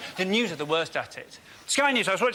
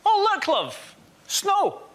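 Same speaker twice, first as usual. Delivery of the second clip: high, breezy and unbothered; casual Scottish shrug; 10 semitones higher than usual. Retold or imitated. Scot greeting snow, breezy and high. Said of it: "Scottish people do this".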